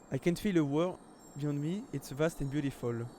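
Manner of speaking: English read aloud with a French accent. Real, not acted. Streamer reading film subtitles to viewers, French accent. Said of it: "désolé mon accent anglais".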